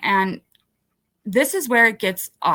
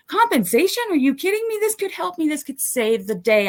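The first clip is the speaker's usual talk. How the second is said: rising, thrilled disbelief at good news; excited discovery; 8 semitones higher than usual. Retold or imitated. Telling the crowd about finding compensation, excited disbelief. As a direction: voice jumps up as hope hits.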